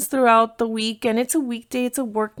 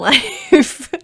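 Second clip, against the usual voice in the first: laughing; 2 semitones higher, 5 dB louder than usual. Real, not acted. Laughs at own dating life.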